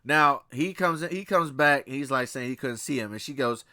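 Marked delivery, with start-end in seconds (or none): none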